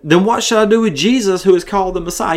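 high-pitched, mildly shocked question. A narrator's reading. Shocked Pilate asking the crowd about Jesus, high-pitched. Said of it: "You know he's shocked".

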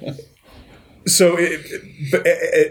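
laughing through the words. Real, not acted. Laughing after asking to say bullshit on the recording. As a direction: giggle after the cheeky swear.

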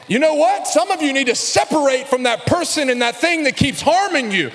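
loud, high fervent preaching. Acted. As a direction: preach it with fire. Fiery sermon passage.

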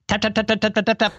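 light high tapping sound; imitated tapping. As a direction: tap it out like a machine. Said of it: "this is almost the last sound I heard".

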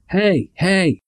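high mock call across the street; lightly voiced bully calling hey.

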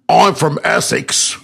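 mock Essex brag; British podcast host imitating an Essex accent. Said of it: "In case you couldn't tell".

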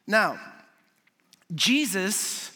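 ordinary delivery

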